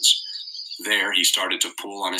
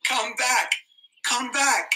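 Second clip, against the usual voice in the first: mild screamed plea; slower than usual. A narrator's reading. Ralph on the cliff calls after the ship, high and desperate. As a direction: plead with the vanishing ship, pitched high. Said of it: "and screamed at the ship".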